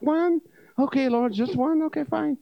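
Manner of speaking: high, whiny complaint to the Lord; mock upset. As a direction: grumble to God like a sulky kid. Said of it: "And I was upset".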